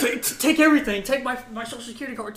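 high, stammering comic surrender; mock panic. Scared recruit handing everything over, mock panic.

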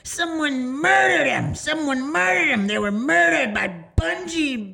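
high, loud, drawn-out mock wail; crazed ranting woman. Woman raving about her murdered children, loud high wail. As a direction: wail it slowly in imitation of her.

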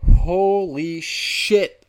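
loud, high, drawn-out exclamation; exasperated outburst. Real, not acted. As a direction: stretch each word out loudly in disbelief.

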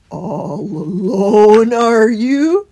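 very slow, loud, drawn-out question; kindly hedgehog. A narrator's reading. Holgate kindly asking after Christopher's company, slow and loud. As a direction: draw it out in a big character voice. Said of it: "inquired Holgate kindly".

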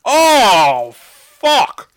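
loud, high disgusted yell; disgusted shout. Real, not acted. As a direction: shout in real disgust at the taste. Tasting a disgusting rum chocolate.

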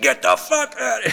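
high, sudden furious yell; mock furious friend yelling; angry shout. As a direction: snap him out the door. Angry friend voiced again.

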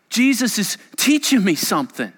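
ordinary delivery

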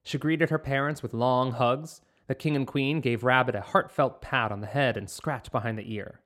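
ordinary delivery, nothing marked

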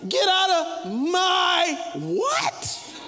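hammed-up parent outrage; mock indignation. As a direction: act offended and drag it out. Preacher mocks the kid.

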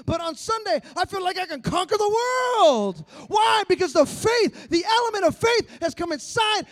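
loud, building preacher climax; preaching. Acted. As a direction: rise to a shouted climax.

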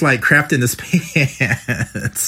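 slow down as the giggle takes over; breaking into laughter. Real, not acted. Interviewer joking about the scared friend, breaking into laughter. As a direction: laugh through the last words.

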